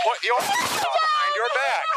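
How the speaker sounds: very high, slow shouted order; barking police commands. Real, not acted. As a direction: yell the command over the struggle. Officer shouts during struggle.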